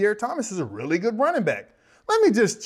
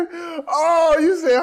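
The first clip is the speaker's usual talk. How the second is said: usual speaking voice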